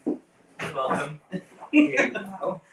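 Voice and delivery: slow, sultry diva drawl; campy drag queen. Acted. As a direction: vamp it up, slow and teasing. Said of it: "So seductive".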